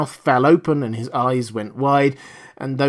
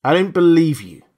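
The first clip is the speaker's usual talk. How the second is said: blunt; 5 semitones higher, 6 dB louder than usual. A narrator's reading. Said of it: "said Burkhart bluntly".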